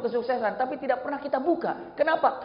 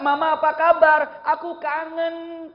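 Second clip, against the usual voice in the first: put-on higher, slow, sweet child's plea; child calling mother; 6 semitones higher, 7 dB louder, slower than usual. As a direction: coo it like a child missing mum. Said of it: "Begitu kata-kata". (anak menelepon ibunya, suara manja dan rindu)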